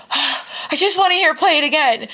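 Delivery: put-on whimpering little-girl pout; pouting child. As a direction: imitate a kid pouting for her song. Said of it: "She's, like, pouting".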